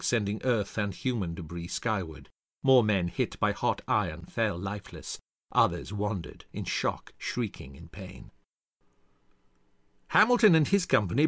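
usual speaking voice

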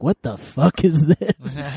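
put-on slow, drawn-out drunken disbelief; mock tipsy confusion, words stretched out; imitated drunk bewilderment. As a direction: play yourself drunk and baffled. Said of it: "like, drunk, like".

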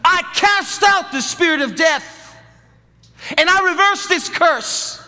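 high, loud, slow declaration; declaring prayer. Shown as a demonstration. Pastor leading the woman in prayer over her womb, loud slow declaration. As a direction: proclaim it with full force. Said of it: "I want you to say this".

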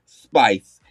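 ordinary talk, nothing marked

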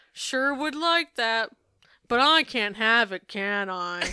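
put-on slow, drawn-out sly little girl; hint at wanting it, sweetly; coy angling child. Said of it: "She says".

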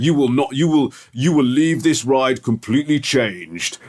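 slow, doom-laden announcer voice, lightly done; ominous ride warning. Retold or imitated. Retelling the ride's spooky warning, slow mock-ominous voice. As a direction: drag it out like a haunted-house sign. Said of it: "and all this sort of stuff".

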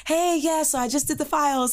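imitating own high, put-on office voice; high-pitched work voice. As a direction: pitch it up for the coworkers. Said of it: "this voice is exhausting to use".

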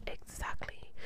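very soft, voiceless whisper; whispered agreement. Retold or imitated. Client agreeing about not mothering him, whispered.

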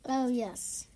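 ordinary talk, nothing marked

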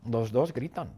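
usual speaking voice

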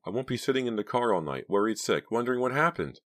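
high character voice; worried young woman. A narrator's reading. Driver explaining why she'll come in, worried.

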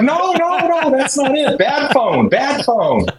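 very high, hammed-up protest; mock protest. Telling family he isn't buying dinner, high mock protest.

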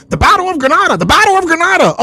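high, mock-excited parroting; mocking Moors. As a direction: repeat the slogan like a tired parrot. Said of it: "I was so tired of Moors talking about".

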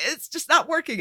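high-pitched complaining verdict; retold dismay. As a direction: pitch it up in mock despair. Retells exasperated reaction to bad accents.

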